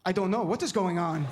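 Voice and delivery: put-on baffled dad's Middle Eastern accent; imitated Middle Eastern accent, puzzled. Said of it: "my dad has an accent".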